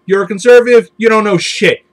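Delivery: ordinary delivery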